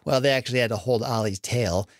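ordinary delivery, nothing marked

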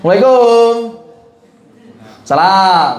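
loud, long-drawn Depok-style reply; Depok-accented salam. Shown as a demonstration. Teacher parodies a Depok local answering salam, Depok accent. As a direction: stretch the greeting out loudly in a Depok accent. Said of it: "Orang Depok itu".

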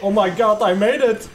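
loud, high, thrilled yell; excited shout. Real, not acted. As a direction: shout it out in triumph. Player escapes the zombies.

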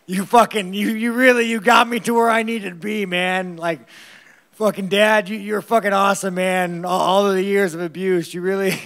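hammed-up thank-you to the man who hurt him; mock gratitude. Fighter sarcastically thanks his abusive dad, loud and bitter.

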